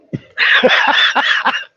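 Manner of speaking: high breathy laugh that falls away; real laugh. Real, not acted. Recalling the crowd's reaction, a high falling laugh. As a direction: crack up at the punchline. Laughing at his own story.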